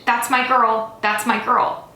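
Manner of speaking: loud, insistent anguished shout; desperate yelling. A narrator's reading. Man held back from his girl, desperate yelling. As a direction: yell it to make them let you through. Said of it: "I screamed".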